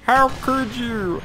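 high, slow wail of betrayal; voicing the gun. Gamer voicing the gun he shot, a high hammed-up wail. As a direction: play the wounded gun, hammed-up. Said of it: "the gun's like".

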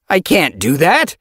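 high, loud yelp of refusal. Acted. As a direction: blurt it out sharply. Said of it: "Ragged Pelt yelped".

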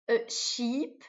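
ordinary talk, nothing marked

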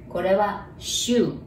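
ordinary delivery

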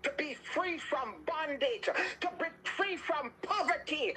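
high, insistent, repetitive prayer cadence; fervent deliverance prayer. Real, not acted. Preacher praying listeners free from bondage, fervent deliverance. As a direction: push each plea out with urgency.

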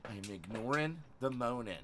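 quiet. Real, not acted. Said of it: "no longer gonna raise my voice".

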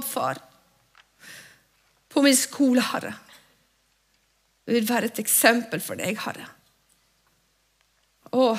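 earnest prayer, each line ending on Herre; spoken prayer. Acted. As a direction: pray aloud for the congregation. Leder bønn foran menigheten. (inderlig bønn ledet for menigheten)

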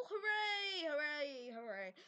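cheer, then let each one drop away; fading cheer. Pretend. Cheering compatibility, dying away.

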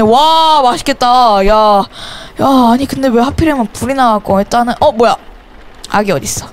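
usual speaking voice

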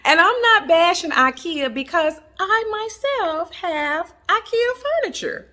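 loud, high-pitched self-defense; amused defensiveness. Real, not acted. Vlogger admitting she owns ikea furniture, high amused protest. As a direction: pitch soaring in playful protest.

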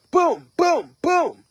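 high, punchy "boom" sound effects; cartoonish self-punching noises; imitated punches. Mimics punching oneself.

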